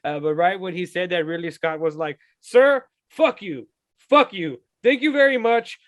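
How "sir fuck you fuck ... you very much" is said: put-on loud, slow, angry old man cursing out a journalist; hammed-up furious grandpa snapping; imitating an angry director telling a reporter off; mock angry outburst. Angry director quote, retold.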